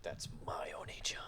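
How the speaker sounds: breathy mock whisper. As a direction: whisper it like a clingy sister. Mock whisper of little sister.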